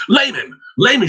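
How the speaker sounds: high frantic cry; scream. Acted. Girl urging the dog, high frantic scream. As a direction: shriek the command at the dog. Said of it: "she screamed".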